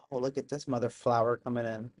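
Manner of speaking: normal talking voice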